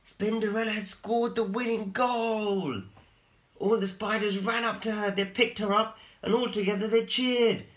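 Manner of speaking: high, loud, thrilled telling; excited narration. A narrator's reading. Teacher narrates the winning goal, high and excited. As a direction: tell it with rising thrill.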